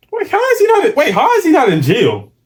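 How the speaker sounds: a high, startled "wait" that cracks upward; outraged disbelief. Real, not acted. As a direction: blurt it out in real shock. Streamer outraged at the story.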